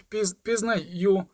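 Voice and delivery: slow, faltering stammer. A narrator's reading. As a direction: barely get the word out. Stunned boy stammers.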